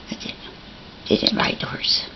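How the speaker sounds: soft coaxing whisper. Real, not acted. Mother coaxes her boy onto the horse, whispering.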